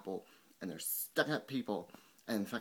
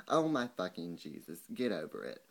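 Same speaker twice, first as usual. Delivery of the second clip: ordinary talk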